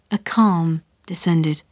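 plain reading voice

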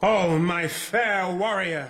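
high, very drawn-out declamation; Othello greeting Desdemona. Acted. Othello hails his bride on stage, high and slow. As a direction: stretch the greeting out grandly. Second take of the Othello line.

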